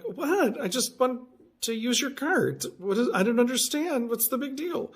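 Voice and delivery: incredulous, high-pitched what-is-going-on; baffled protest. Retold or imitated. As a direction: replay your own confused objection. Baffled retort to woman yelling German.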